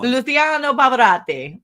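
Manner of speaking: loud, high, slow hammed-up Italian accent; mock Italian accent. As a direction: roll out the name like a big Italian tenor, badly faked. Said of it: "It was my Italian accent".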